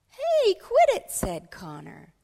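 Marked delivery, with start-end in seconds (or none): 0.1-1.1 high, loud protest; indignant yell. Acted. Connor objects as David takes his cookie, indignant yell. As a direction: yell it out as an annoyed schoolboy.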